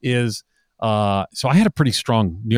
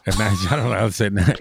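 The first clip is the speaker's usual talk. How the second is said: ordinary delivery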